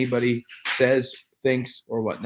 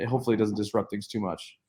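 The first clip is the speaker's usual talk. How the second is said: normal talking voice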